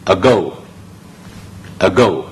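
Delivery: ago, said slowly with stress on go; slow teacher model of ago; correct pronunciation. Shown as a demonstration. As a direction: model ago carefully, stress the second syllable. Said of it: "成個字嘅發音呢就係".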